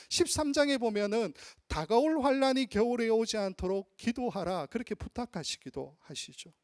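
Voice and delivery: normal talking voice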